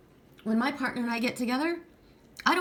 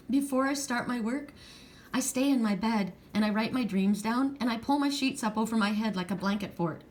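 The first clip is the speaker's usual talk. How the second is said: ordinary delivery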